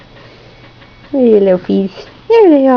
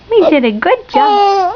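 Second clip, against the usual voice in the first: bright singsong praise; high cooing praise; 6 semitones higher than usual. Real, not acted. Mother praising baby after hiccups pass, pitched up.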